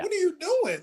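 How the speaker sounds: a retold cry of disbelief at the comic; exasperated disbelief. Scolding the old-school comic for his remark, incredulous. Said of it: "I'm like".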